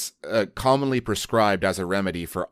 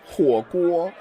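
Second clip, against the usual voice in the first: whiny diner; 9 semitones higher, 3 dB louder than usual. Acted. Acted dialogue answer.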